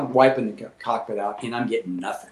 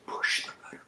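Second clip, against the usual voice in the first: hushed whispered prompt; barely audible, breath-only command; mysterious whisper; 13 dB quieter than usual. Retold or imitated. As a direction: whisper it like a voice from above. Voicing a God-sent inner prompt.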